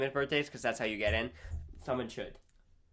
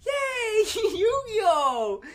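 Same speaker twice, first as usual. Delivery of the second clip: very high, pretend celebration with a laugh; mock cheering; 4 dB louder, much slower than usual. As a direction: cheer as if throwing confetti. Acts out tossing confetti in celebration.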